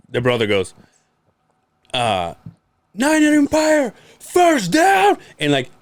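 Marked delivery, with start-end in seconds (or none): 2.9-5.2 high, loud sports call; announcer shout. Retold or imitated. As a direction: bellow it like a stadium announcer. Brother announcing a first down.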